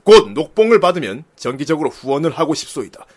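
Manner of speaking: low, measured voice of an ancient general; cool general's reading. Acted. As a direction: read the letter with stately, dashing gravity. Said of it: "이거 좀 멋있게 읽어야겠다".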